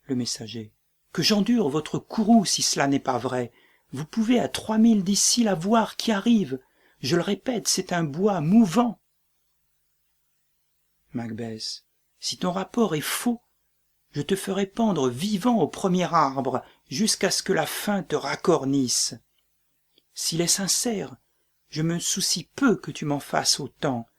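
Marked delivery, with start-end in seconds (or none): 1.1-9.0 urgent pleading. Acted. Frightened messenger insists. 12.2-24.1 raised threat. Acted. Macbeth threatens the messenger.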